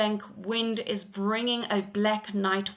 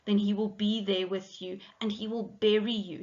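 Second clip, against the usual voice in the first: usual speaking voice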